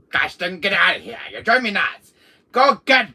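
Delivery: rising to a high furious yell; angry shout building. Acted. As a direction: build from gripe to full scream. Chasing Penguin away.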